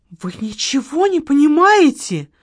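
high, loud flash of temper; flare up. A narrator's reading. Widow flares up at the marquis, high and loud. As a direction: blurt it out offended. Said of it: "вспыхнула Маргарита".

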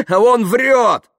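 high, loud, drawn-out cry; accusing shout. Acted. Yuklin denouncing Kaftanov to Dasha, accusing shout. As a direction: yell it as the final proof.

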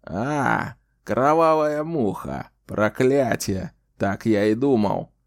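put-on annoyed old man swearing; irritated cursing. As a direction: snap out the curses, slowed. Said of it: "раздражённо прихлопнул её и выругался".

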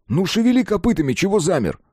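high-pitched impatient prodding; impatient urging. A narrator's reading. Narrator pushes Колян toward the exit, urging impatiently. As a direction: hurry him along, pitch shooting up.

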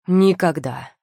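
loud, decisive refusal; emphatic. A narrator's reading. Виллиан shuts down Демиар's question, emphatic. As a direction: rule it out with force. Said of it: "припечатал Виллиан".

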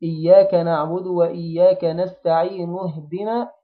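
slow recitation running نستعين straight into اهدنا; linking hamza dropped. Shown as a demonstration. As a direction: join نستعين to اهدنا with no glottal stop. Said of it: "في وسط جملة لا تنطق". (وصل نستعين باهدنا دون نطق الهمزة)

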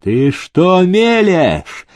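slow, high incredulous fury; enraged master's outburst; infuriated. A narrator's reading. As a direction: rage with every word drawn out. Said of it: "взбесился Демидов".

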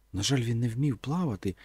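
ordinary delivery

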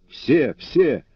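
two high, punched exclamations; insistent crowd cry. A narrator's reading. Rebels answer their leader that all will stay, insistent cry.

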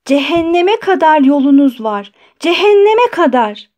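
high, loud cry after them; tearful scream. A narrator's reading. Doctor running after departing visitors, screaming, near tears. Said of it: "ağlamaklı bir sesle haykırdı".

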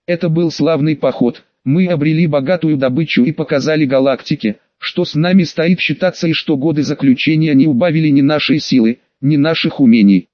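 ordinary delivery

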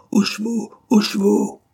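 frantic shout to the troop. Acted. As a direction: yell the call to horses.

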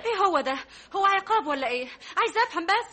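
loud, high protest at being punished; indignant protest. Acted. Daughter protests loudly at her father's punishment. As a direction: demand an explanation loudly.

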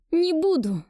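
high, loud refusal; defiant shout. Acted. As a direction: snap it out. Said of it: "вырвалась я".

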